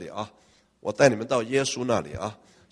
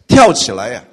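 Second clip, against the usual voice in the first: loud, thrilled burst; preacher marveling at the leap, loud; excited exclaim; 14 dB louder than usual. Real, not acted. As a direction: shout the wonder of it.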